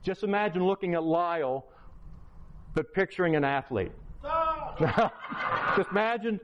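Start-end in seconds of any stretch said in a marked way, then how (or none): 4.2-6.4 real laugh breaking into the words. Real, not acted. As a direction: laugh at the picture of Lyle. Laughing at picturing Lyle.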